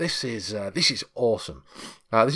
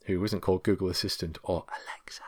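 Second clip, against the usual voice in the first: a sly whisper tucked into the sentence; whispered joke aside; 3 semitones lower, 8 dB quieter than usual. Acted. Host whispers the assistant names as a cheeky aside.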